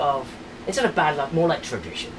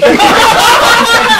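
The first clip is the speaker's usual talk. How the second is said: loud high laugh; 15 dB louder than usual. Real, not acted. Laughing mid-chant.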